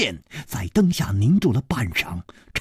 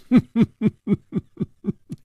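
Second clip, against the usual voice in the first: short amused laugh, pitched up; knowing laugh; 7 semitones higher than usual. A narrator's reading. Chu Liuxiang laughing at Li's correct guess. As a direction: laugh as if pleased he guessed right.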